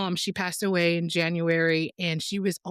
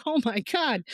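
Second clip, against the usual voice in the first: higher-pitched starstruck gasp of words; 6 semitones higher than usual. Retold or imitated. Telling of meeting a BBC cameraman, starstruck. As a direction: act out being starstruck. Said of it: "I was starstruck".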